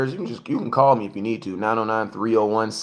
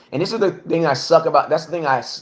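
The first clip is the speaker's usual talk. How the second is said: normal talking voice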